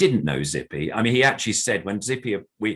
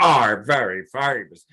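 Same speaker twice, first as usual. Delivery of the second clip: high, louder squeaky puppet character; hammed-up higher puppet voice, much louder; 4 semitones higher, 8 dB louder than usual. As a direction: pop up and thank the crowd as the puppet. Answering Adam as the puppet.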